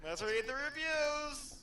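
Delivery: high, slow eager announcement; excited. Real, not acted. Friend proposing to read reviews, excited and high. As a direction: sing it out, thrilled to start.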